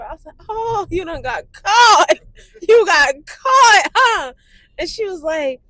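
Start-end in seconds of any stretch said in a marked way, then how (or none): 0.5-4.3 loud, high, drawn-out taunt; gleeful teasing. Retold or imitated. Daughter teasing mother who got pulled over, gleeful taunt. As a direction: rub it in slowly and loudly.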